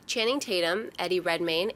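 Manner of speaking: ordinary talk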